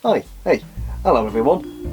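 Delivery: ordinary delivery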